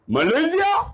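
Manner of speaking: high, rising surprised question; startled. Retold or imitated. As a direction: imitate someone taken aback. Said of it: "Dia terkejut".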